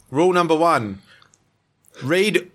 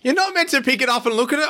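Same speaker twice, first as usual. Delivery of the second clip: much higher exasperated protest; 12 semitones higher than usual. Real, not acted. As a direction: cry out in mock outrage. Guest spots the answer early.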